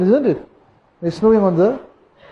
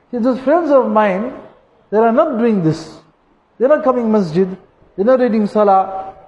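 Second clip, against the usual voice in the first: usual speaking voice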